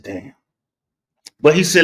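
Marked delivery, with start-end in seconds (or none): 0.0-0.3 quiet shock. Retold or imitated. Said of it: "I said".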